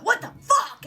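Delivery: loud, high shocked swearing; outraged yell. Retold or imitated. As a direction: imitate dad's outraged outburst. Dad's reaction to prank, retold.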